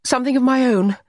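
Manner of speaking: high, loud, sharp retort; sharp and curt. A narrator's reading. Allegra answers John about the piece, sharply and curtly. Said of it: "She spoke sharply and curtly".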